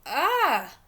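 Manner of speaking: loud, high gasp of fright; a short scare-movie cry; startled "ah". Acted. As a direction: let out a scared "ah" at the sight. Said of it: "I kinda went".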